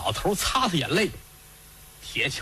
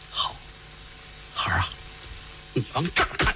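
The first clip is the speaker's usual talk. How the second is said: put-on low hushed father calling his child; a father murmuring over a pit; 4 semitones lower, 3 dB quieter, faster than usual. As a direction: draw her close in a low hush. Father whispers to daughter.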